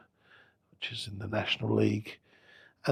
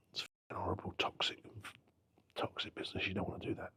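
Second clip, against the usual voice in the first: hushed warning; 3 semitones lower, 12 dB quieter than usual. Retold or imitated. Contact warns him off football, retold.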